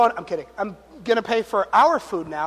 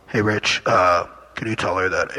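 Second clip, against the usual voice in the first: much lower, booming divine voice; imitating a deep God voice; mock voice of God; 13 semitones lower, 4 dB louder than usual. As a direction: boom it out like a voice from heaven. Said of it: "it wasn't like God was like".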